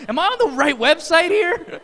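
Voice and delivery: high and loud, half laughing in disbelief. Retold or imitated. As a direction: laugh it out like you can't believe the page. Joking disbelief at the website.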